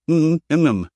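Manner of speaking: muffled mm noises behind a hand; hummed mm through a covered mouth. A narrator's reading. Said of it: "With her mouth covered".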